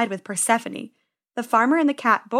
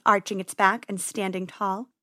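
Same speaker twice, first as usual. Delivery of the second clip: ordinary delivery, nothing marked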